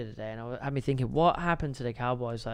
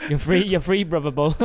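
laughing blessing; 4 dB louder than usual. Pretend.

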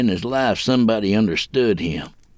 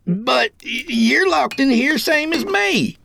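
high-pitched alarmed yell. A narrator's reading. Cuffed kid to his keeper, yelled out. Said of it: "he yelled out".

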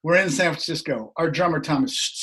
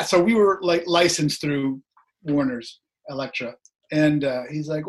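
ordinary talk

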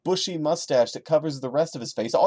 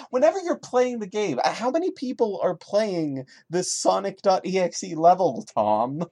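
sarcastic repeat; 5 semitones higher than usual. Real, not acted. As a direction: throw the line back with scorn. Mocks the line he just read.

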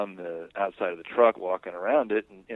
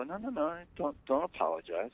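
higher, softer quick waving-off; hasty reassurance; 9 semitones higher, 4 dB quieter than usual. Retold or imitated. Husband telling the guys not to apologize, hasty reassurance. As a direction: brush the apology aside.

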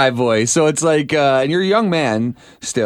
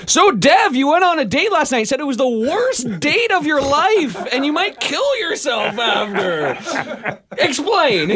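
much higher, overblown radio host; hyped Taz impression; 11 semitones higher, slower than usual. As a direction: blow it up like an on-air hype man. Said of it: "here's my impression of Taz".

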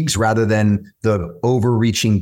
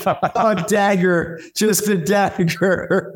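much higher, slow, hammed-up heartbreak; mock wounded; 9 semitones higher, much slower than usual. Dad stung by his son's [name] put-down, higher and mock-wounded. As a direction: play it like a dagger to the heart.

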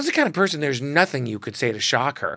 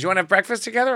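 put-on much higher, brassy old diva; Joan Rivers; 7 semitones higher, much faster than usual. Joan quizzing the early photographer, brassy squawk. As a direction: squawk it like a comedy legend. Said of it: "She goes".

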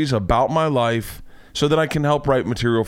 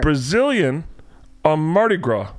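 higher, fumbling request to a doctor; embarrassed patient; 4 semitones higher than usual. Retold or imitated. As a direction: stammer it out awkwardly. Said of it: "tell them".

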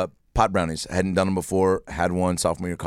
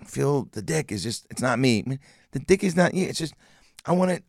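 usual speaking voice

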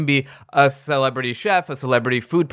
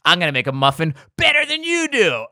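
Bobby Flay swagger. Retold or imitated. Chef surprising the muffin ladies, cocky and showy. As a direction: burst in like a smug celebrity chef. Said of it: "Bobby Flay ... is like".

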